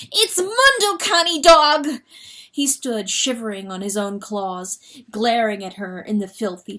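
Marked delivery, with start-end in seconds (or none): 0.1-2.0 high, loud shriek. Acted. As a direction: shriek the name in terror. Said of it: "Chanticleer shrieked".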